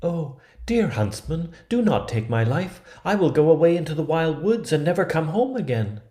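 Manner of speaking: put-on a frightened girl begging at knifepoint; pleading for her life. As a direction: beg the huntsman to spare you, high and quick. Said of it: "she began to weep and to say".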